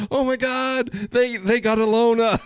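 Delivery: very high squeal through laughter; squealing chicken panic, laughing; laughing mock shriek. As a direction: shriek it while cracking up.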